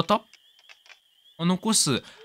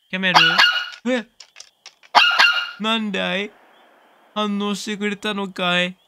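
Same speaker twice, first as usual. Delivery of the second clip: higher voice, sweet-talking the puppy; high-pitched doting voice; 6 semitones higher, 4 dB louder than usual. Real, not acted. Owner cooing to his virtual puppy, voice pitched up. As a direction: coo up high at the dog like a fond owner. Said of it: "なんか、甲高い声で".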